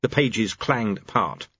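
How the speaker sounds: plain reading voice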